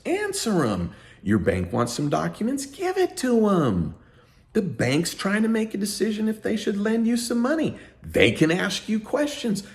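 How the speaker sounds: high, stern lecture to whining clients; scolding a client. Retold or imitated. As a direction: talk down to a whiny client. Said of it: "chapter telling clients".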